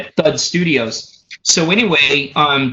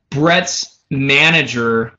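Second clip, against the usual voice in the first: ordinary talk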